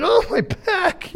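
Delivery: high, slow, strained whimper; drawn-out, pained mock wail; mock groan of pain. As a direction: ham up an aching back. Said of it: "I'm like".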